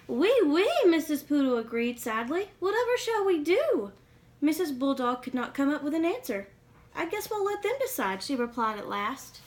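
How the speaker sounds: put-on lively read-aloud for kids; storybook reading. A narrator's reading. Reading a picture book to young listeners, lively storytelling.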